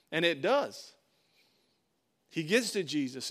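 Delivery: ordinary talk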